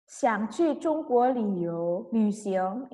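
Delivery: very slow, drawn-out words; slow model reading. Shown as a demonstration. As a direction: dictate it slowly to the class. Teacher dictates ending. (membaca sangat pelan)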